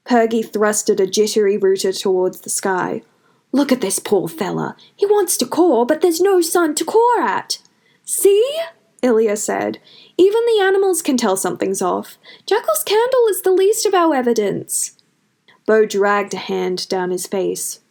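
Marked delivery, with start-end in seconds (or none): 3.5-7.6 a lightly voiced farmer, rising in pity; Pergy, pitying. A narrator's reading. 8.1-14.9 put-on much higher, bright girl's voice, urging; rally everyone to speak up; eager young Ilya. A narrator's reading.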